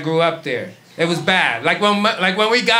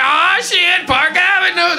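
much higher, thrilled cry; dad picturing the fancy life, over the moon; excited dad; 12 semitones higher, 3 dB louder, slower than usual. Retold or imitated. Father imagining Park Avenue, thrilled and high. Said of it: "He was like".

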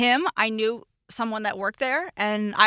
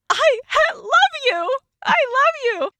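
high, loud, pleading I love you; breathless love declaration; 4 dB louder, slower than usual. Retold or imitated. To the friend leaving on Barrington Avenue, high desperate I love you. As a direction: blurt it out high and desperate, running after him. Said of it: "I was like".

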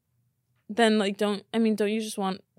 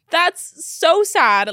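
slow, loud, higher lament; so sad; 7 semitones higher, 8 dB louder, much slower than usual. Real, not acted. Reacting to the ditched date, slow loud lament. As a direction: drag it out, upset. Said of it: "so upset right now".

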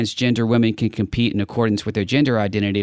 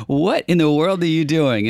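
higher, baffled teasing reaction; mock bafflement; 7 semitones higher than usual. Host teasing co-host over the accent, mock bafflement. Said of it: "I was like".

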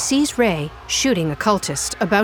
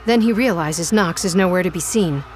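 ordinary talk

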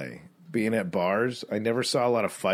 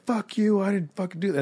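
retold scream; 9 semitones higher than usual. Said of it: "he's screaming at somebody like".

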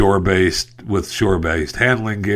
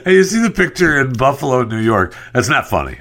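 normal talking voice

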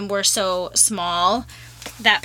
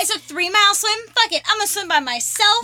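mock defiance; 8 semitones higher than usual. Mock defiant cave rant.

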